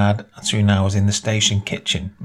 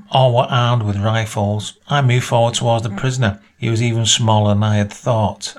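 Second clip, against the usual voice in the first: plain reading voice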